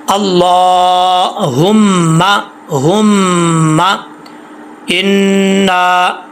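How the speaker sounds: अल्लाहुम्मा with a heavy, full-throated ला and long humming मीम; इन्ना with a held nasal नून. Shown as a demonstration. Teacher demonstrating the heavy reading, slow. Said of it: "भारी आवाज़ के साथ पढ़ेंगे".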